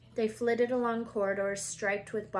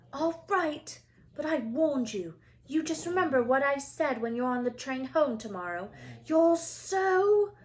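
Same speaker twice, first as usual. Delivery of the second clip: higher, indignant schoolgirl warning; huffy warning; 5 semitones higher, 6 dB louder than usual. Acted. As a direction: wag a finger as you warn them. Hermione's parting warning.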